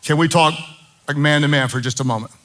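loud, high, impassioned sermon build; fiery preaching. Acted. Pastor rallying the men of the church, fiery preaching.

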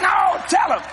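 insistent shout. Retold or imitated. Preacher urging the laughing interpreter, shouting. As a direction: push him to tell it.

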